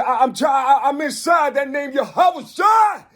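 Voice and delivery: high, loud fiery sermon shout; preaching at full force. Real, not acted. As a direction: cry it out as a fiery prophet.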